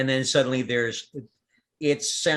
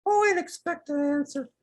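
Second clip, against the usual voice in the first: tiny plant voice. Acted. A tiny plant voice answering the gardener, very high. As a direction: go way up small and squeaky. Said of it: "one little tiny voice that goes".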